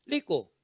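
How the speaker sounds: put-on child echoing "rico" as "liko"; son repeating mispronunciation. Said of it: "hijo dice".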